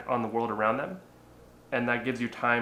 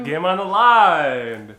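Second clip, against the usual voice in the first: slow, loud, higher hype call; sports announcer; 6 semitones higher, 13 dB louder, much slower than usual. Pretend. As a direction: boom it out like a stadium announcer. Echoes dramatic game tension.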